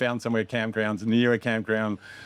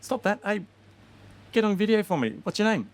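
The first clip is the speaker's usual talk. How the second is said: hyped-up filmmaker; 12 semitones higher than usual. Retold or imitated. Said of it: "and then I'd be like".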